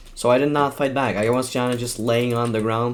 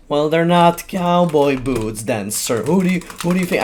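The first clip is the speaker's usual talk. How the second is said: loud, smug and slowed, higher; smug retort; 5 semitones higher, 6 dB louder than usual. Retold or imitated. To the classmate, a smug loud retort. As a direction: taunt him, loud and pleased with yourself. Mocking reply in argument.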